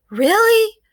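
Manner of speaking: put-on eager child's lifted question; excited. A narrator's reading. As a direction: squeal it with delighted disbelief. Child hears guests are coming.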